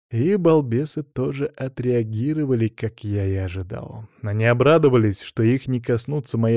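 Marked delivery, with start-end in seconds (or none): none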